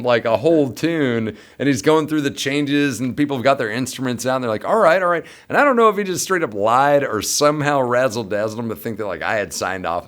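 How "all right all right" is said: eager agreement. Retold or imitated. Band members agreeing.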